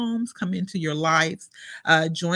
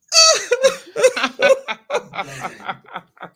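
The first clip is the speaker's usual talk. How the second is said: emotional laugh-cry; 7 dB louder than usual. Real, not acted. Breaking down while reading.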